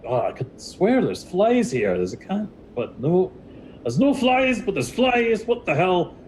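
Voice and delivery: high, puzzled voice swatting at phantom flies; confused muttering. Retold or imitated. Player character puzzled by buzzing flies, high confused voice. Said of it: "he's, he's like".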